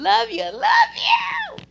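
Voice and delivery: high, loud, drawn-out love you; loud shout of love. Real, not acted. Shouting love to his late friend Kentrell, loud and slow.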